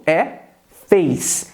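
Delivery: ordinary delivery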